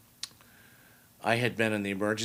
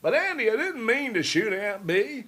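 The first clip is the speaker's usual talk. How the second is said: much higher, a jittery hammed-up Barney Fife; Don Knotts; 12 semitones higher, 8 dB louder than usual. Asked for Don Knotts, a high nervous deputy impression. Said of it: "Don Knotts".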